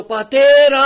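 a loud, high call of «Πατέρα!»; crying out. Retold or imitated. Preacher reliving his cry to God in the empty shop, a loud call. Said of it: "Και φωνάζω".

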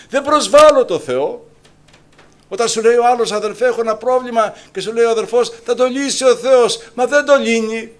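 high, loud fiery sermon; fiery preacher; full-force preaching. Acted. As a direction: preach it out at the top of your voice.

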